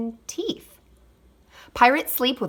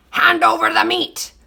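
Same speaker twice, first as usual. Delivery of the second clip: pirate demand; 9 semitones higher, 6 dB louder than usual. A narrator's reading. Pirate captain demanding food.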